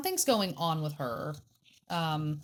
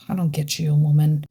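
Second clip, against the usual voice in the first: exasperated; 12 dB louder than usual. Real, not acted. Exasperated sigh at friend.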